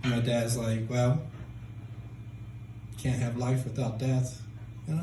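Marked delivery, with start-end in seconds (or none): 0.9-4.4 slow, softer deadpan; emotionless dad. Retold or imitated. Mexican dad answering news of a death, emotionless. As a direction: deliver it slowly, without feeling. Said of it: "he's kind of emotionless".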